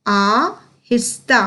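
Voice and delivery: आहिस्ता split as आ, हिस्ता with a pause; slow syllable by syllable. Shown as a demonstration. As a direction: sound out आहिस्ता slowly in two parts. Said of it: "उच्चारण पर बहुत ही अधिक ध्यान". (आ-हिस्ता को तोड़कर धीरे बोलना)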